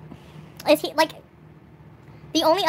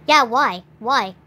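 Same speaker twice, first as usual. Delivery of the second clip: ordinary talk, nothing marked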